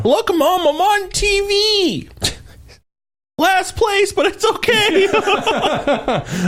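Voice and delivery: high silly voice. Pretend. Mock golfer on TV, silly voice.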